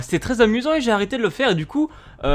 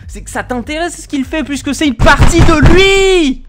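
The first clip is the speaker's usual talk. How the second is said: exasperated rant; 6 semitones higher, 8 dB louder than usual. Real, not acted. Ranting loud.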